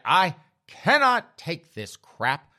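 loud, high, slow exasperated outburst; losing his mind. Retold or imitated. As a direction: play a dad at breaking point, stretching each word out. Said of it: "he lost his mind at that point".

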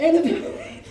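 high-pitched startled cry; high mock-panicked question; mock alarm. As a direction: act out a man's shocked discovery. Man discovers his loss, acted.

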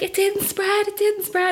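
high, thrilled cry of relief; excited scream. Retold or imitated. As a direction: shriek the good news to your mum. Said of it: "screaming down the phone, excited".

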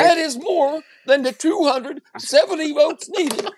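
patronizing anchorman lecture, emphatic and over-enunciated; talking down. Acted. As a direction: explain it as if to a moron. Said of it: "he talks to you like you're a moron".

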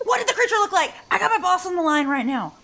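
high, urgent plea for help; frantic asking. Acted. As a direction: ask in a panic while the boss waits. Urgently asks friends, boss waiting.

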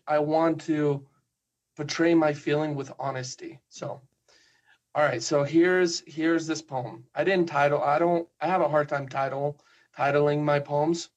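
ordinary talk

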